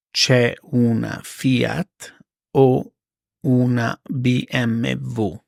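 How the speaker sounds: slow, spaced-out repeat; slow it down for learners; careful slow teacher read; slow and clear. Shown as a demonstration. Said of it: "slow repeat for learners".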